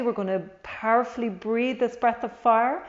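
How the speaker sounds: normal talking voice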